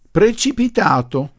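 high, loud, drawn-out interjection; bursting out. A narrator's reading. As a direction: blurt it out shrilly, stretching the word. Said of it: "proruppe una delle zitellone". (sbotto acuto e forte da zitellona)

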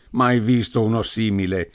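plain reading voice

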